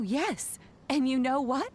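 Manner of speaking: ordinary delivery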